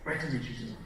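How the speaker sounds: subdued, easy hello; matching a quiet client. Acted. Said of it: "So if they're quiet, you go in".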